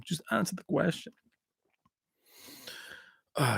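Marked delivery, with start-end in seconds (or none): 0.0-1.1 put-on soft accented action-star catchphrase; murmured mock tough-guy line; Van Damme impression. Remembering the films fondly, quiet Van Damme impression. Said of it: "Oh, Van Damme".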